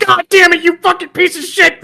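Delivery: high-pitched, comic outburst of rage; exasperated cursing. Retold or imitated. Gamer swearing at a deadly blade, exasperated. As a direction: curse at the game, voice shooting up.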